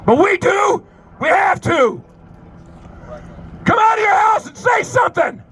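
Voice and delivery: high, full-voiced protest call; rally shout. Real, not acted. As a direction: call out to the whole street. Protest leader shouting to the street.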